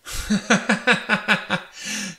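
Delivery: sudden high, unsettling cackle; high staccato laughter falling away; eerie high-pitched laugh. A narrator's reading. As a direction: burst into a long disturbing laugh. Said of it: "éclata de rire. D'un rire au perché".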